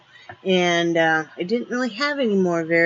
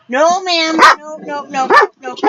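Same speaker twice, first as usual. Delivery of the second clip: stern no; 8 semitones higher, 8 dB louder than usual. Real, not acted. Sternly stopping the dog.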